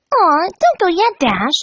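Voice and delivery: high, pouting pony; Pinkie Pie voice. Acted. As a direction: whine cutely. Pinkie pleads.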